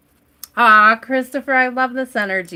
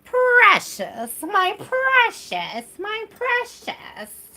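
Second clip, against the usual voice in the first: high, slow, hissing "precious" like Gollum; 13 semitones higher, much slower than usual. Pretend. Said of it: "say that with a lot of extra S".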